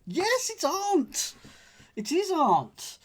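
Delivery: high, drawn-out gleeful yes; triumphant. Real, not acted. Solver crowing over a cryptic clue, triumphant. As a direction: crow with delight at the answer.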